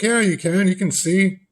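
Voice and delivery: put-on 2 high, pleading child voice; 3 voice the small boy insisting; 4 a high kid's voice, mock-earnest.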